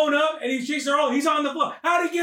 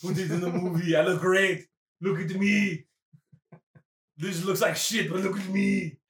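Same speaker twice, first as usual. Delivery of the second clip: low, lazy acted Arnold talk; self-satisfied movie star; 8 semitones lower than usual. Arnold insisting he looks great in the movie, smug. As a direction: drop the pitch and sound pleased with yourself. Acting out Arnold, drinking, posing.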